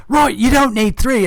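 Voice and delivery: high, loud and eager; eager agreement. Acted. Bandit leader eagerly agreeing to kill his own comrade, high and loud. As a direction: jump at the chance to kill.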